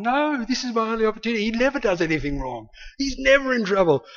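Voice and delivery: high, stretched-out mock protest; whining protest. Narrator arguing with conscience over his only opportunity, protesting. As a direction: argue back in a high, petulant plea.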